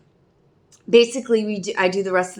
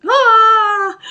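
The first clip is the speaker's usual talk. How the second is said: high loud anguished ah; anguished cry; 13 semitones higher, 10 dB louder than usual. Real, not acted. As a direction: let out a sudden distressed wail. Panic moment, cry out.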